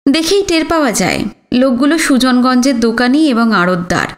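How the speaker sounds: ordinary delivery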